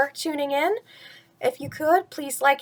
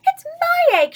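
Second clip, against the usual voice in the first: very high, louder indignant outburst; angry sputter; 7 dB louder than usual. Acted. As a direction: sputter out her claim to the egg. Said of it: "she sputtered".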